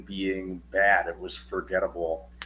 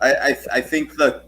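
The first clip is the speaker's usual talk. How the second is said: laughing; 6 semitones higher, 7 dB louder than usual. Real, not acted. Laughs while starting his point.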